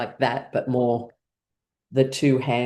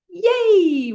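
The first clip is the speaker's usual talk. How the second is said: high, loud cheer of yay; excited cheer; 6 dB louder than usual. Shown as a demonstration. Teacher shows the class an excited yay, cheering high. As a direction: throw the arms up and cheer. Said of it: "Do that with me".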